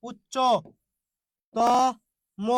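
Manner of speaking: উচ্চ, ত, ম as separate pieces, "uchcho... to... mo"; syllable-by-syllable breakdown. Shown as a demonstration. Teacher breaking up the conjunct for students, উচ্চ, ত, ম piece by piece. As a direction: break উচ্চ, ত, ম apart with long pauses. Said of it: "যুক্ত বর্ণ ভাঙলেন".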